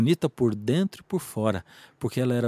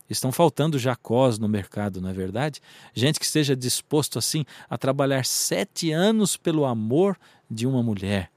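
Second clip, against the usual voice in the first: ordinary talk, nothing marked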